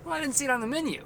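mock argument.

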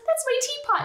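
high, loud, thrilled little voice; excited. Retold or imitated. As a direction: squeal it with delight. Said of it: "she says it so excited".

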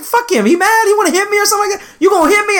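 high-pitched, indignant challenge; cocky, goading retort; defiant self-defense. Retold or imitated. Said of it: "like, defending himself".

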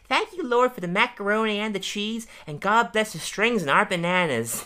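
put-on high, sweet little-boy grace; child's voice for Todd. Todd saying grace over macaroni, high child voice. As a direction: pray like a small earnest boy.

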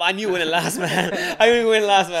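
high, loud, laughing through the words; real laughter bubbling over; laughing admission. Real, not acted. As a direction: confess it while cracking up. Laughing at own free food scheme.

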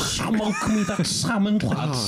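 mock Scouse. Joining the Scouse impressions.

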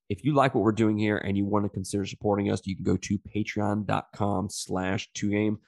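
ordinary talk

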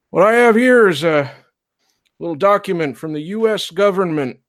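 put-on old marshal. Old marshal facing down the preacher, firm and high. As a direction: calmly overrule the preacher, slowing at the end. GM voices marshal addressing crowd.

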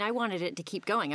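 normal talking voice

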